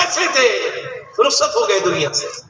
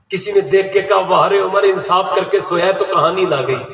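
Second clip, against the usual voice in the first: calm sermon; 4 semitones lower than usual. Real, not acted. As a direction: settle the crowd and speak gently. Lower calm sermon passage.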